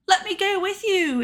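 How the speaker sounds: high-pitched mock plea; pleading Belle. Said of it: "Belle's all".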